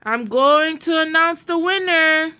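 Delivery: loud, high announcing call; a judge calling the crowd to hear the winner, booming; booming announcement. A narrator's reading. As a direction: boom it out to the crowd. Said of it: "Her voice boomed".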